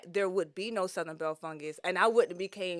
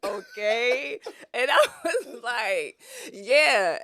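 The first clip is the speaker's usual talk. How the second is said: laughing; 4 semitones higher, 7 dB louder, much slower than usual. Real, not acted. Retelling awkward exchange, laughing.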